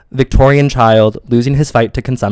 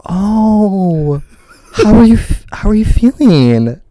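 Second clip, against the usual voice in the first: lightly voiced, pitched-up sympathetic question; hammed-up gentle concern; mock concerned friend; 6 semitones higher, much slower than usual. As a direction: imitate a friend fussing over you, a bit higher. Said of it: "Peru just looked at me and he was like".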